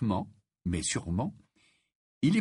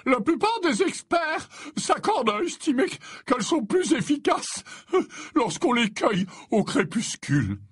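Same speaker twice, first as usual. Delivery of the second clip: high-pitched, put-on professor voice; courteous professor; 13 semitones higher, 3 dB louder than usual. A narrator's reading. Professor tells colleague when to pick plants, courteous. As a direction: hold forth in a high, affable voice. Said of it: "Je suis tout à fait d'accord".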